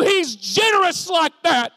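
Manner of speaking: high, loud proclamation; preacher's shout. Real, not acted. Preacher declaring God's generosity to Russ, shouted. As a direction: proclaim it at full force. Climax of sermon refrain.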